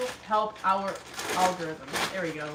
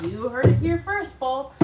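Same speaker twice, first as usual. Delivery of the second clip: mock announcer; 4 semitones higher, 12 dB louder than usual.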